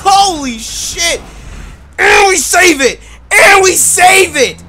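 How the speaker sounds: high, loud, slowed cheer of relief; excited shout. Real, not acted. Streamer celebrating the ambulance save, excited shout. Ambulance nearly flips, saved.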